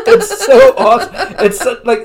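high, loud, through real laughter; laughing hard. Real, not acted. Telling a co-host about a favorite joke, cracking up. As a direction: barely get it out for laughing.

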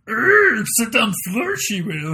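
lightly voiced Yoda; backwards-talking Yoda with a grunt to start; a hint of the little Jedi master; Yoda impression. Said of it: "[name] just does a Yoda impression".

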